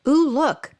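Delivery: put-on teacher showing excitement to a child reader; excited ooh. Shown as a demonstration. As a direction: sound delighted at the picture. Modeling excitement on picture walk.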